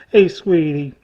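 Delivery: normal talking voice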